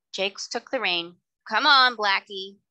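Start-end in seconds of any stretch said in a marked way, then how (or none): 1.5-2.6 slow, louder call to the horse; urging call. Acted. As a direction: urge the horse forward. Jakes urges horse on.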